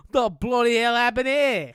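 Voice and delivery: loud, hammed-up Aussie accent; terrible Australian accent. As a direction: do a badly faked, baffled Australian. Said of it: "terrible accent".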